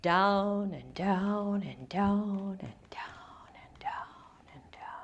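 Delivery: slow, sinking into a whisper; fading descent. A narrator's reading. Narrating the second dive.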